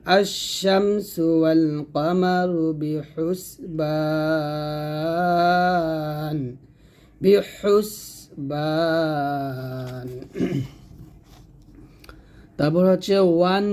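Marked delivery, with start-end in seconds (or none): 0.1-10.1 slow chant, holding "বা" of "বিহুসবান" very long; long madd. Shown as a demonstration. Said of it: "বাতে তিন আলিফ টান দিয়ে". 13.2-13.6 "ওয়ান" with a sustained nasal ending; ghunna. Shown as a demonstration. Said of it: "গুণা করবেন".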